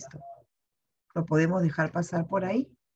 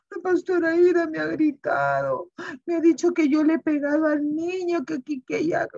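put-on whiny child; 11 semitones higher than usual. Child complaining to his mother.

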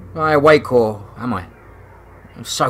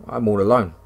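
ordinary delivery, nothing marked

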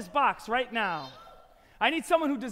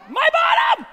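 very high, loud squawk; mock-horrified shriek; 13 semitones higher, 9 dB louder than usual. Magician finds the shoelace in his bottom, shrieking high. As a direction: yelp it in comic outrage.

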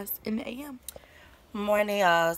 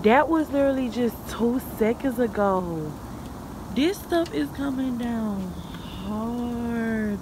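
amazed; 4 semitones higher, 4 dB louder than usual. Real, not acted. Amazed at the snowfall.

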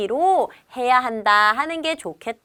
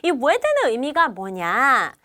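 ordinary delivery

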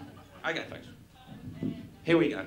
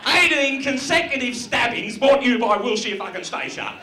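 louder, higher mock outrage, hammering eighteen; outraged punchline; 6 semitones higher, 5 dB louder than usual. Comic ranting to the crowd about a slasher ad, loud. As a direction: bellow the sponsor line like it's a scandal.

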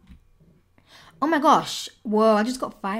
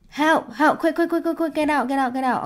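fast, frantic, higher calls; panicked pleading; 6 semitones higher, 3 dB louder than usual. Real, not acted. Gamer trapped and losing health, frantic pleas. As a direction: beg to get out quickly.

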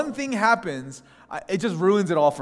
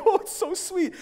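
very high, mock-weepy cooing; tearful gushing friends. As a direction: gush sweetly like a moved friend. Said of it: "They're crying. They're like".

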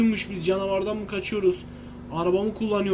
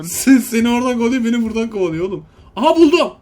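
being chased; 2 semitones higher, 7 dB louder than usual. Real, not acted.

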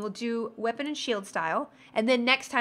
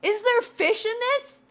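surprised; 11 semitones higher, 3 dB louder, slower than usual. Real, not acted. Tasting a strange snack.

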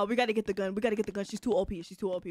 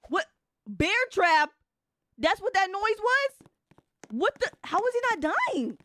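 high, loud disbelief; baffled, raised-voice exclaiming; incredulous; 8 semitones higher, 5 dB louder than usual. Real, not acted. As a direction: question it in sheer astonishment. Baffled by what happened.

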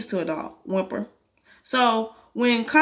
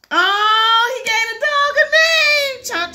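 high, loud singsong squeal; delighted gushing; 14 semitones higher, 7 dB louder, much slower than usual. Real, not acted. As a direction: draw it out slowly, cooing with delight. Reader gushes at the story's turn.

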